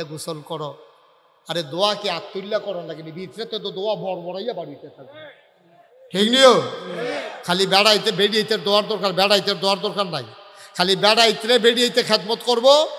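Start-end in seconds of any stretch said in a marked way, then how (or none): 6.1-12.9 raised-voice preacher's scolding; loud haranguing. Real, not acted. Preacher taking husbands to task over wives, loud harangue. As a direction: drive it at the crowd loudly.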